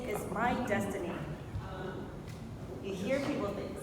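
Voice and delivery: ordinary talk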